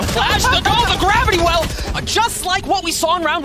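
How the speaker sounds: high, loud yell; excited shout. Real, not acted. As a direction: shout the play out. Caster yells at big play.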